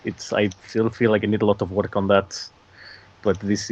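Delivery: rough attempt at a Russian accent. Shown as a demonstration. Guest shows how he would do it, Russian accent. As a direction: put on a work-in-progress Russian accent. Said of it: "a more of a Russian accent".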